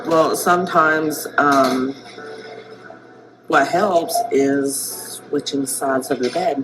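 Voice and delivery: slow, chewing gum while talking. Real, not acted. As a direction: chew gum between slow words. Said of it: "Sorry, I've gum in my mouth".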